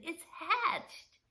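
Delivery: high, slow gasping announcement; boy out of breath telling Pop; breathless boy. Acted. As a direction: get the news out between breaths. Breathless boy tells news.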